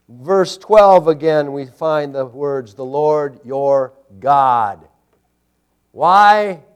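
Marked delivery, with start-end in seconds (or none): 2.7-4.9 "Lord your God" stretched very slowly; emphatic stress. Real, not acted. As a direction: set "your" and "God" apart with weight. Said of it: "the emphasis".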